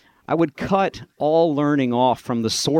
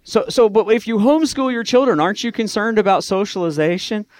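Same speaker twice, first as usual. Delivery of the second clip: worried questioner; 8 semitones higher than usual. Retold or imitated. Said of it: "people are like".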